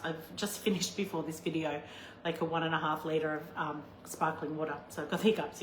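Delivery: usual speaking voice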